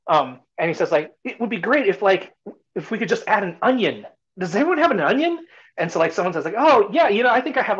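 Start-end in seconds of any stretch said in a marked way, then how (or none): 1.2-5.4 much higher, bright coaxing ask; coaxing stranger. Retold or imitated. Stranger coaxing villagers for an onion. 6.6-7.8 much higher, louder eager offer; volunteer it happily; eager villager. Retold or imitated. Villager offering the stranger an onion, eager.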